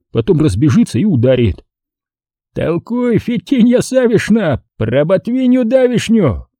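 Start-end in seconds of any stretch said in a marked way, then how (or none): 2.5-6.5 slow, very high-pitched cry; battle exclamation. A narrator's reading. Brawler exclaiming as he charges headfirst, crying out. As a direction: sing out a taunt as you strike. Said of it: "при этом воскликнет".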